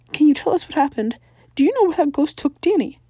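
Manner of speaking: high, loud anxious mom; urgent questioning. A narrator's reading. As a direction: press them for answers. Maddie urgently questioning kids.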